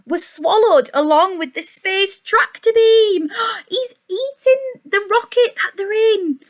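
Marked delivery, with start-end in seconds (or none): 3.5-6.4 gasp, then high astonished exclaim; mock surprise. A narrator's reading. Reader gasping as the dinosaur eats the rocket. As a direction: act shocked for the little listener. Shocked aside to listener.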